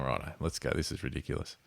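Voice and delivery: hushed, fed-up mock grumble under the breath; half-whispered, resigned to packing up; weary mutter.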